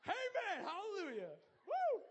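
very high, very loud whooping; hammed-up praise shout. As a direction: overdo the hallelujah to cover a nap. Overdoing amen after waking up.

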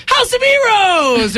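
high, slow shouted greeting; mock announcer shout; excited shout. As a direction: yell it like a surprise at the door. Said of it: "and it's like".

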